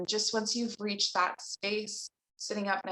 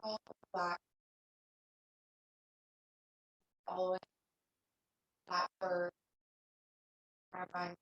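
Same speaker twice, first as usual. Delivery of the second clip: soft, unhurried meditation voice with long pauses; guided meditation; 8 dB quieter than usual. Real, not acted. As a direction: guide them gently into stillness, leaving long silences. Leads a seated meditation.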